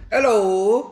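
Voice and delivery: mock-British greeting, high and loud; a hammed-up, badly faked British accent. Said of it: "supposed to be speaking in a British accent".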